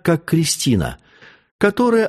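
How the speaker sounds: ordinary delivery, nothing marked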